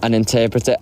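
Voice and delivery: usual speaking voice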